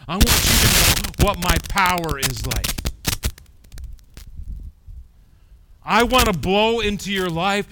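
impassioned pulpit voice; preaching. Acted. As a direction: proclaim it with force. Preaching God's voice.

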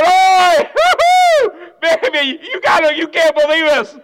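loud high whooping with laughter; God bursting with excitement; excited God. Acted. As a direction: cheer it like great news. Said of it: "God's excited".

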